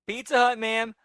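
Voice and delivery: slightly high, timid delivery call; meek reply. Retold or imitated.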